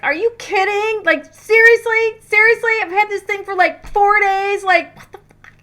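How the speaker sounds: high, loud mock outrage; tantrum. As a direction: throw a bratty fit over the lost ring. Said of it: "such a boo-boo kitty tantrum".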